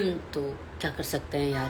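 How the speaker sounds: ordinary talk, nothing marked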